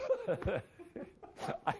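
a short laugh at himself. Real, not acted. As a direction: laugh off the silly singing. Laughs after singing.

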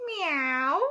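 high meow like a cat. Acted. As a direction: mew it like a cat. Cat revealed in the story.

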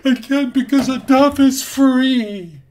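high, full-force yelling, breaking down; acted anguished shouting. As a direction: build to a desperate scream, stretching the last word. Acted breakdown in therapy quarrel.

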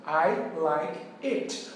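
"like" said very slowly, each word separated; teacher slowly spelling out the correct "like" for learners; slow clear demo. Shown as a demonstration. Very slow model phrase. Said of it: "você vai dizer".

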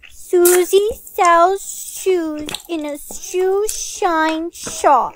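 slow, careful tongue twister; very slowly. Shown as a demonstration. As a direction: read it word by word, scared of slipping. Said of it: "you need to say it very slowly".

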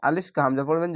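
usual speaking voice

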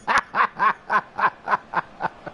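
high run of laughter; teasing laugh. Real, not acted. Streamer amused by his own teasing, high laugh. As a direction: burst out laughing at your tease.

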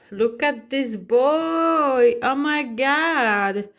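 slow, loud drawn-out exclaim; hammed-up exclamation. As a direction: stretch each word theatrically. Exaggerated exclaim.

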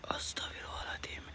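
hushed, voiceless whisper; whispered prayer. Real, not acted. Ghost hunter murmuring istighfar to himself, whispered. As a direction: whisper it under your breath.

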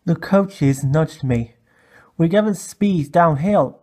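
ordinary delivery

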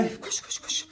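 urgent whisper; hushed order to run. Real, not acted. Urgent hushed command to flee.

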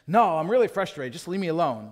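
mock-frustrated husband pushing her away; hammed-up irritated husband; frustrated refusal. Husband refusing, retold scene.

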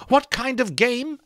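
high, sharp angry cry; Molly furious at the refusal. A narrator's reading. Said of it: "Molly cried furiously".

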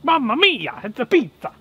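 high, hammed-up Italian accent, "pitsa"; big Italian chef cheer; jolly italian pizza chef. Italian exclamations over finished pizza.

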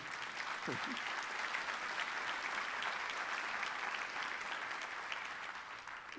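airless rising chuckle; breathy laugh. Real, not acted. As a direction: let out a soft voiceless laugh. Laughs in front of congregation.

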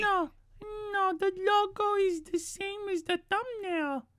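a put-on cute anime streamer voice; lightly voiced chirpy VTuber. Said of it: "I'm gonna stop with that voice".

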